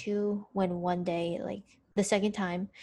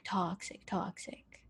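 "toxic, toxic" whispered, dying away to nothing; trailing dramatic whisper; fading whisper; 10 dB quieter, slower than usual. Real, not acted. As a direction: drop "toxic" into a whisper and let it vanish.

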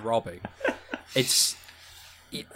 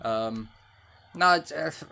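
ordinary talk, nothing marked

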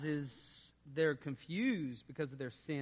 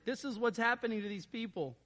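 ordinary delivery

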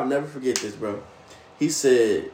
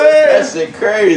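laughing; 4 semitones higher, 3 dB louder, slower than usual. Real, not acted. Drunk laughing at gossip.

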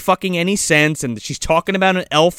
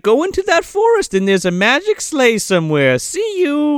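high, chirpy brush-off; breezy Santa; 9 semitones higher than usual. Retold or imitated. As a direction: wave them off cheerfully. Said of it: "he's like".

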